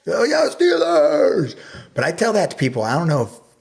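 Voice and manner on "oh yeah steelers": much higher, loud, slow stadium yell; imitating dad's cheer. Son imitating his father yelling at the Steelers. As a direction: belt it out like a fan at the game. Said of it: "the only time I hear him scream is at Steelers".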